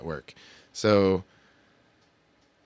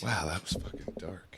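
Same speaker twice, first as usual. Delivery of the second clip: whisper; 10 dB quieter than usual. Real, not acted. Aside after dark joke.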